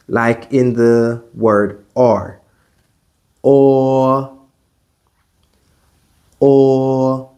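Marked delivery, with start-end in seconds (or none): 3.4-7.3 "Or" with lips slightly rounded; "Or" rounded, like in English or; Mandarin o vowel. Shown as a demonstration. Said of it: "round your lips slightly".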